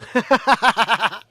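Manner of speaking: rapid staccato chuckle; real rattling laugh. Real, not acted. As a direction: laugh in a quick run of ha-has. Laughs at a chat comment.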